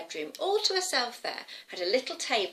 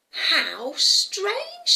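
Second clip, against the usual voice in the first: high, loud wonderment; amazed little girl; 11 semitones higher, 6 dB louder than usual. Acted. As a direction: marvel aloud like a puzzled child. Girl marvels at the cat.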